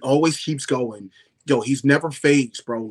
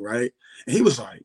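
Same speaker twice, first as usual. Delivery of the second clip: usual speaking voice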